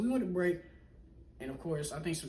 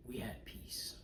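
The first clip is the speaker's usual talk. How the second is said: whispered plea; 6 semitones lower, 11 dB quieter, slower than usual. Retold or imitated. Pleading with the angry coworker.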